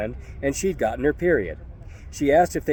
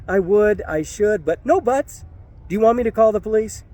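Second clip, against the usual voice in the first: higher and faster, tumbling words; flustered pleading; 8 semitones higher than usual. Retold or imitated. Upset woman answering the narrator, flustered and higher. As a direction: rush it out anxiously.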